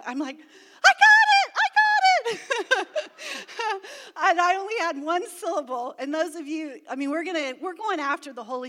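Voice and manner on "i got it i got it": much higher, louder, slow shout of joy; overjoyed. Retold or imitated. New believer who just got tongues, overjoyed and laughing.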